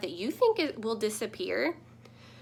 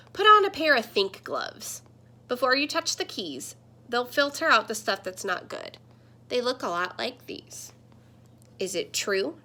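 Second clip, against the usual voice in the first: put-on lively read-aloud storytelling to children; picture book reading; 4 dB louder than usual. Teacher reading a picture book to her class, lively storytelling.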